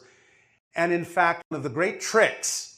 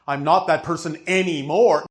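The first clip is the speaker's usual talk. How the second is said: mock protest; 3 semitones higher, 9 dB louder than usual. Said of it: "it's not even about".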